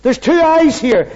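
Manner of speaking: emphatic pulpit delivery; high, loud sermon voice; full-force preaching. Acted. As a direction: preach it out with conviction.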